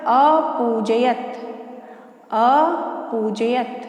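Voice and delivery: अपूजयत stretched very slowly, a-poo-ja-yat; slow careful pronunciation. Shown as a demonstration. Teacher slowly models अपूजयत for students to repeat. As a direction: drag out each syllable of अपूजयत. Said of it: "उच्चारित करके दिखा देती हूँ".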